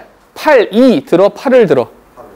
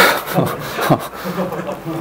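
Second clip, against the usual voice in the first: quiet, voiceless laugh; soft chuckle; 9 dB quieter than usual. Real, not acted. Teacher joking to students about time flying, soft chuckle. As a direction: chuckle under the breath.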